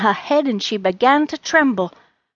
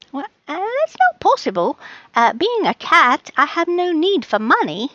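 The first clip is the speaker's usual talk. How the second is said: high cat voice; 7 semitones higher than usual. Acted. Charlie voice.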